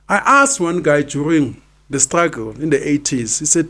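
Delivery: normal talking voice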